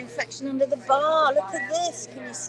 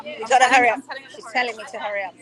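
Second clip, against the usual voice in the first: normal talking voice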